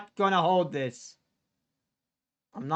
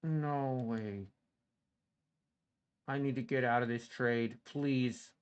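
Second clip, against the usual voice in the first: low, soft, defeated murmur; crushed, quiet despair; despairing hush; 7 semitones lower, 11 dB quieter than usual. Real, not acted. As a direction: barely get the words out. Despair after losing trade.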